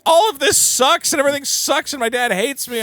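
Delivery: high-pitched run-on complaint; mock teenage whine. Voicing Shinji's whining complaint.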